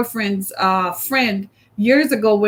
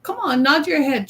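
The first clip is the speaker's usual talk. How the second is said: ordinary delivery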